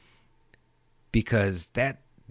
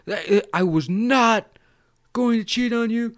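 mock emphatic denial; 11 semitones higher, 5 dB louder than usual. Imitated Cotton denying cheating to girlfriend, emphatic. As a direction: stretch out "not" and insist. Repeats denial more deliberately.

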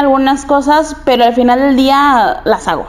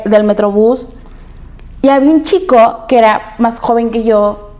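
normal talking voice

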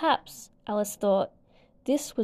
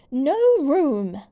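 loud, higher shout of refusal; 4 semitones higher, 8 dB louder than usual. A narrator's reading. Tea party pair refuse Alice a seat, shouting loudly. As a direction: bark it out to keep her away. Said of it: "the pair shouted".

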